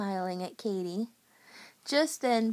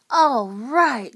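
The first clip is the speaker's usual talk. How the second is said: loud, drawn-out cheer; 10 dB louder than usual. A narrator's reading. Jeremy applauding the pizza toss, loud cheer. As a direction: cheer on the trick. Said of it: "Jeremy cheered".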